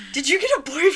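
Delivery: high, loud teasing question. Retold or imitated. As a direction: tease her playfully. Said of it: "she said".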